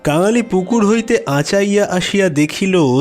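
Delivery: plain reading voice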